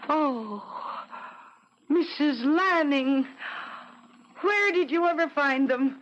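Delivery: overwhelmed with delight and disbelief; astonished. Acted. Astonished at drapes.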